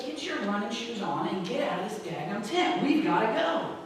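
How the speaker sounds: softly spoken retold command; quiet order. Friend sends the quitting racer back out, quiet order. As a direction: keep the friend's order understated.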